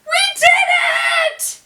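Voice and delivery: very high, loud joyful whoop. A narrator's reading. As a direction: whoop with triumph. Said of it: "Imogene whooped".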